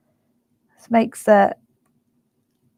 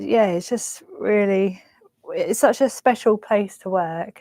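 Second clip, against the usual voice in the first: normal talking voice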